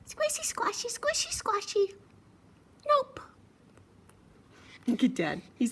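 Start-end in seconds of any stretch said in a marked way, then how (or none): 0.1-3.2 very high, tiny piggy; cheeky little pig refusing; piggy singsong then a stubborn nope; squeaky piggy voice. Acted. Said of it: "But the piggy answered".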